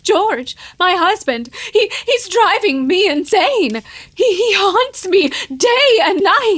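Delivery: rising, panicky plea, pitch climbing; near-hysterical wife, getting shrill and slow at the end; frantic pleading. Acted. Wife begging doctor to stop husband.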